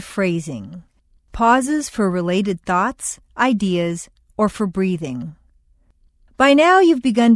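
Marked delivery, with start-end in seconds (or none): none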